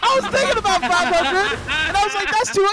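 high, loud laughing rage; raging gamer. Real, not acted. Raging about the missed money.

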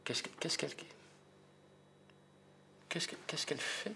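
high, soft, whispered bewilderment; hushed puzzled aside; baffled whisper. Acted. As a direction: murmur it in disbelief. Baffled watching Odile.

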